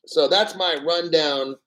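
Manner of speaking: ordinary delivery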